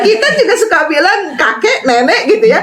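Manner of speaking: high, giggly talk after a burst of laughter; laughing. Real, not acted. Laughing over husband's aki-aki remark. (bicara sambil tertawa geli)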